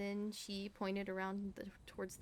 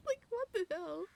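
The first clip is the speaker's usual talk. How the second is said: indignant rant; 8 semitones higher, 5 dB louder than usual. Real, not acted. Indignant rant about officer.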